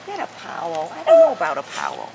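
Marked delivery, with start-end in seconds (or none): none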